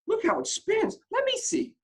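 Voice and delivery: put-on high, loud child voices; excited children. A narrator's reading. Children eager to see the bulb, high and loud. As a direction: squeal like kids pushing forward. Children pushing through crowd.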